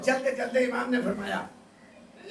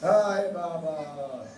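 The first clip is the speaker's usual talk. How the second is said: grieving lament; 6 semitones lower, slower than usual. Acted. Sakina's lament, voice breaking.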